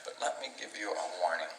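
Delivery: usual speaking voice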